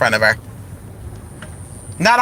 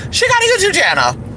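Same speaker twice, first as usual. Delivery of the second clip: much higher, near-squealed reveal; excited squeal. Retold or imitated. Retelling meeting a neighbor at the mailbox, squealed high. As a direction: blurt it out like juicy gossip.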